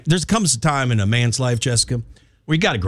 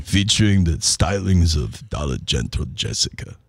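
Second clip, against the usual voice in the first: lower, lightly voiced Rocky; Rocky impression; 5 semitones lower than usual. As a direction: pitch it as Rocky. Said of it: "I do my Rocky".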